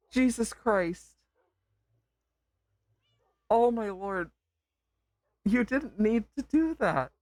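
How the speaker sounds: high-pitched emotional disbelief; overwhelmed, near tears. Real, not acted. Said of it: "Can hear it in my voice".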